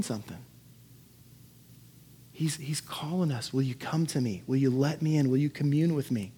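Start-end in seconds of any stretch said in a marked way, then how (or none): none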